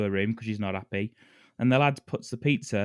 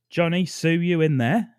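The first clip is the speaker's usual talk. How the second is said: raised, louder mock Cockney call; Cockney accent; 6 semitones higher, 6 dB louder than usual. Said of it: "in his fucking Cockney accent".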